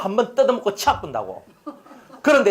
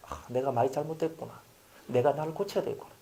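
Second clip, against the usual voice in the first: quiet self-reflection; 6 semitones lower, 11 dB quieter than usual. Retold or imitated.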